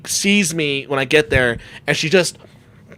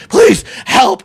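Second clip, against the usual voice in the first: louder, higher pleading cry; begging passersby for help; 9 semitones higher, 4 dB louder than usual. Retold or imitated. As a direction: beg strangers on the street for help. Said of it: "I'm like".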